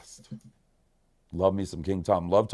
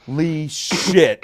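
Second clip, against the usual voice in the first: stunned; 6 semitones higher, 7 dB louder, much slower than usual. Real, not acted. Stunned by voicemail.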